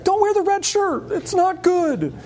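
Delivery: high, urgent plea to the screen; mock warning. Star Trek fan pleading with the screen, mock urgent. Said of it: "Star Trek".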